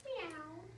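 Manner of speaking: soft kitten meow. Acted. Cat sound in picture book.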